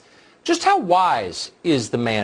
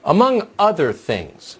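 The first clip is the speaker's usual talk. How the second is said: slow, raised, pointed quotation; pointed echo; 4 semitones higher, 4 dB louder, slower than usual. Retold or imitated. News host quoting Speaker Pelosi back to viewers, pointedly. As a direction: quote it slowly and pointedly, pitched up. Pointedly echoing the clip just played.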